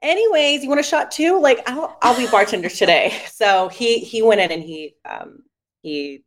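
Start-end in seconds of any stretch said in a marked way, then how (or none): 0.0-3.1 very high, bright offer of a shot; chirpy brush-off. Retold or imitated. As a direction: breezily move on, pitch way up. Offering shots after breakup.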